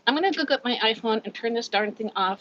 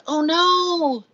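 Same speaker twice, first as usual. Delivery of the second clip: shocked sympathy; 8 semitones higher, 6 dB louder than usual. Real, not acted. Reacting to viewer's illness.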